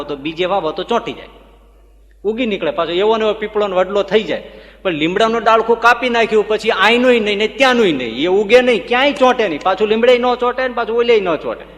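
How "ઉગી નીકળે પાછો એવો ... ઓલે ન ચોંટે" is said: higher, louder preacher's voice driving the point home; fervent preaching. Acted. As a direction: preach it with rising force. Preacher builds the neem parable.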